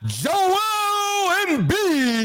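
booming arena announcer call; hype PA announcer. Retold or imitated. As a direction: hype up the home star. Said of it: "you gotta sound like the P A announcer".

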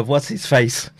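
usual speaking voice

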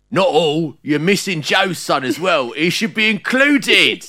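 high, loud mock commenter voice; put-on fan voice. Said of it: "I will do an accent for this".

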